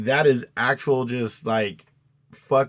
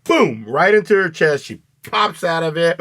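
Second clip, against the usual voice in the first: usual speaking voice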